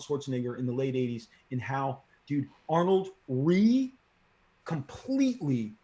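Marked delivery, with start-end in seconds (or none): none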